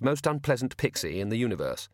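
plain reading voice

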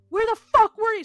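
high, very loud imitated outburst; exploding in a retold scream; acted furious yell. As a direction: yell it like a frantic mother at her child. Mother confronts the returned child.

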